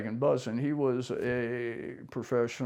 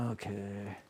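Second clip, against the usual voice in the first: meek okay; 4 semitones lower, 12 dB quieter than usual. Retold or imitated. Meek reply at gunpoint.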